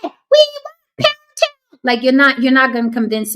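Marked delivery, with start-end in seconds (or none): 0.0-1.8 put-on very high squeaky chanting kids; hammed-up high-pitched group yell; mock yelling. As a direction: shriek it like a mocked classroom mob. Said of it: "all yelling simultaneously".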